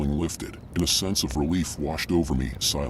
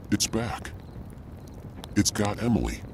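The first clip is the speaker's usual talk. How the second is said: higher, trembling frightened voice; frantic cry; 4 semitones higher, 3 dB quieter than usual. A narrator's reading. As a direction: cry out in panic, voice shaking. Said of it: "she cried, voice trembling".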